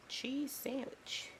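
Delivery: slow, high, stretched-out presentation; drawn-out reveal. Acted. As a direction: stretch it out proudly like a big reveal. Proudly presenting the finished sandwich.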